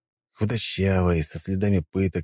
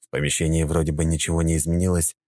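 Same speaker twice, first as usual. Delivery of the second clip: ordinary delivery, nothing marked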